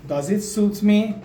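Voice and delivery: each word slow and separate; slow teaching demo. Shown as a demonstration. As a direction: read it out slowly for the class.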